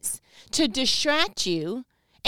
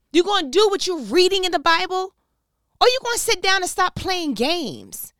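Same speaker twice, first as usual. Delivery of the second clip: loud, higher, slowed angry preaching; a loud scold to stop playing with God; fired-up rant; 9 semitones higher, 8 dB louder than usual. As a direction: lay into her, fired up and scolding. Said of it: "Fired up".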